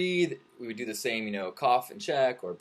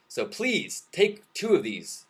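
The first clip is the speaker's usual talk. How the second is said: high, slow pleading; earnest-sounding put-on appeal; mock doctor's plea; 10 semitones higher, 4 dB louder, slower than usual. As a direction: plead like a pretend doctor prescribing. Acting the doctor's mock prescription pitch.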